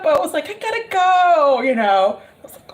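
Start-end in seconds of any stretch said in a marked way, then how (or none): 0.5-1.6 high, pleading exit line; retold apologetic getaway; mock-reluctant excuse. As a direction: pitch it up, begging off.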